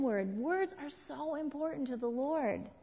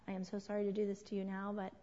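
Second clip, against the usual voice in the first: soft read text; 4 semitones lower, 7 dB quieter, faster than usual. Retold or imitated. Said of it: "on a text".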